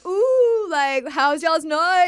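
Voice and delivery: high, slow mocking singsong; 1 teasing friends. Her friends teasing her about the night before, high mocking singsong. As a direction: drag out the tease like a nosy crowd.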